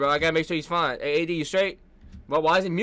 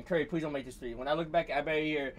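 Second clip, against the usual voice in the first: soft, lower muttered plea; quiet pleading; 5 semitones lower, 13 dB quieter than usual. Real, not acted. Fan praying Curry misses the three, low soft plea. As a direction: beg under the breath. Pleading under breath before a shot.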